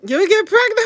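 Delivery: high, loud shocked outburst. Real, not acted. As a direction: blurt it out in disbelief. Reacting to chat in shock.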